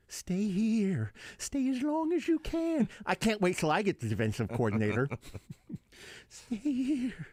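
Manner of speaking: squeaky, stretched-out mock plea; put-on squealing character voice. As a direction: plead in a goofy high voice. High impression of a figure, laughter follows.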